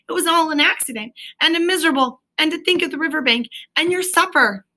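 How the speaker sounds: high, fast tearful protest; a small creature begging to give up; miserable Mole. A narrator's reading. Mole's miserable pleading.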